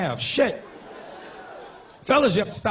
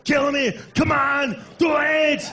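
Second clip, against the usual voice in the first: Arnold yelling in Predator; 6 semitones higher, much slower than usual. Retold or imitated. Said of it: "He's like".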